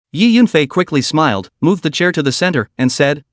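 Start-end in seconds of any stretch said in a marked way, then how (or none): none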